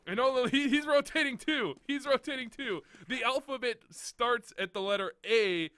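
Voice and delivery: loud, high-pitched exasperation; real exasperated outburst; exasperated rant. Real, not acted. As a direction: raise your voice in disbelief at a student's blunder. Coach exasperated at player's bad decision.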